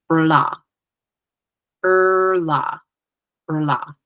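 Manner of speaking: "rla" with a rolled R and light L; Mohawk R sound. Shown as a demonstration. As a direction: roll the tongue into "rla". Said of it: "I would say".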